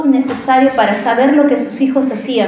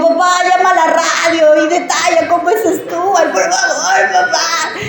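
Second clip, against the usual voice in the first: tearful pleading; 5 semitones higher than usual. Retold or imitated. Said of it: "Mis lágrimas, mi voz y todo lo conmovió".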